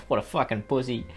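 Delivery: ordinary talk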